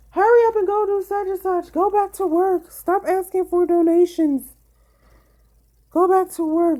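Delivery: loud and high, mimicking pushy critics; mocking nagging commenters. Pregnant streamer mocks commenters telling her to work, loud high mock nag. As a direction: shout it high like a bossy stranger telling her what to do. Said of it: "people be like".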